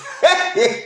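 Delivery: high burst of mocking laughter. Real, not acted. Streamer laughing at her rival's mistake, high burst. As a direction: laugh up at them.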